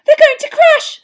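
very high, loud frightened scream. A narrator's reading. Hermione screams in fear as the seekers dive. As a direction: scream in alarm. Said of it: "screamed Hermione".

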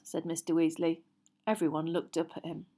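ordinary delivery, nothing marked

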